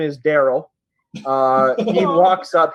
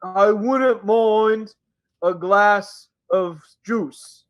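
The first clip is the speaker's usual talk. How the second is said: very slow, shy ogre voice; slow-speaking ogre; 5 semitones higher, much slower than usual. Acted. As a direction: stretch every word like a gentle giant new to talking. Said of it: "he's speaking slow".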